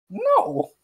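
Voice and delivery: high, squeaky one-word refusal. Acted. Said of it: "he's, like, a higher pitched voice".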